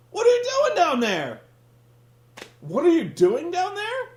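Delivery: high, loud, dopey call repeated; mocking dumb question. Host imitates the wrestler calling to his bleeding partner, high loud dopey voice. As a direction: play the clueless wrestler calling down. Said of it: "and he goes".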